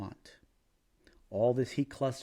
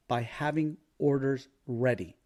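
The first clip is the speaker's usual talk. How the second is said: usual speaking voice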